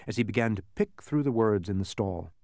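ordinary delivery

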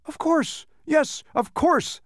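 very high, drawn-out cries; delighted shout. A narrator's reading. Man realizing who he is, delighted shout. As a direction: burst out in high-pitched realization. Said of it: "the man shouted".

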